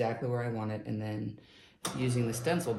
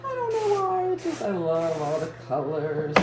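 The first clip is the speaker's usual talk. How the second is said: much higher and louder, gushing; delighted gasp; 6 semitones higher, 6 dB louder than usual. Real, not acted. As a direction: squeal with delight over the colors. Delighted about her fiber bat.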